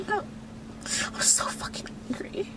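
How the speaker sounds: slow, hushed whisper, nearly voiceless; real upset, barely getting the words out; tearful whisper. Real, not acted. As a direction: whisper it to yourself, drained from crying. Upset, repeating it after crying.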